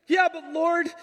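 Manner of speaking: high-pitched protest to God; complaining cry. Retold or imitated. Pastor crying out to the Lord, high complaining shout. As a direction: cry out in loud, pleading complaint. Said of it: "as I'm complaining".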